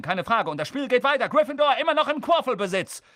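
high, driving play-by-play; excitable match commentator. Acted. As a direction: call the match at full excitement.

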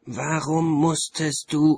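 loud, slow, drawn-out reproach; a big booming, slow complaint from Death; Death lamenting. A narrator's reading. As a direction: stretch it out, loud and aggrieved. Death's line in the story.

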